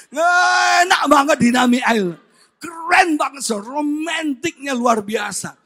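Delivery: loud, stretched opening cry, then easing; gushing excitement. Real, not acted. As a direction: belt out the delight to the crowd. Gushes again about the island. (berseru kagum, keras dan bersemangat)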